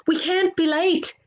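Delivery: high, pressing alarm; a leprechaun hurrying the others off in the rain; urgent warning. Acted. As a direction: push them on before it is too late. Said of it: "Art ordered".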